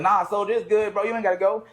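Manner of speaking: mock pleading. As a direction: plead like a fan who's losing his chance. Begging [name] to stay.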